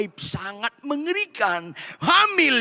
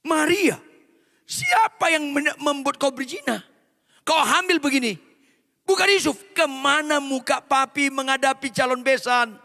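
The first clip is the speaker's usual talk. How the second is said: higher, demanding interrogation; furious father; 7 semitones higher than usual. Acted. Father grilling Maria about who made her pregnant, furious.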